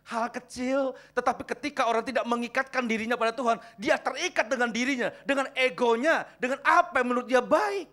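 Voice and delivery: loud, high-pitched exhortation to the congregation; full-force preaching. Real, not acted. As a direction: preach it loud, stretching the key words.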